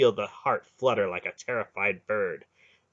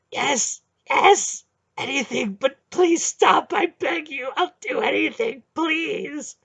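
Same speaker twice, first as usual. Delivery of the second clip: high, loud, panicked pleading; frantic begging; 8 dB louder, slower than usual. Acted. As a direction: beg him to stop, in panic. Hierarch begging to be spared.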